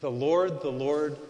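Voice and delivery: loud, ringing repetition; proclaiming. Retold or imitated. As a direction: announce the name with power. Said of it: "And he said".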